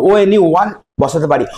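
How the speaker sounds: normal talking voice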